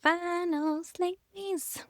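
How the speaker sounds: drawn-out announcer. Acted. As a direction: stretch it out like a show host announcing. Announcing the segment title.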